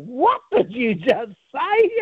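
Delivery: mock outrage from an interviewee caught off guard; indignant Winston. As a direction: play Winston baffled and affronted, drawn out. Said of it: "Winston's going".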